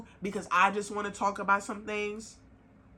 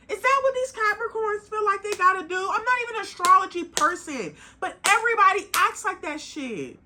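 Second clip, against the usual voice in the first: louder, higher, heated rant; getting mad; 6 semitones higher, 4 dB louder than usual. Real, not acted. Ranting about partners who won't open up, getting mad and loud. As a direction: let the anger climb as you go. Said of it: "'cause I'm getting mad".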